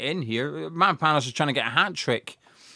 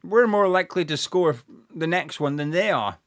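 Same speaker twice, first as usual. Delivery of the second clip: ordinary delivery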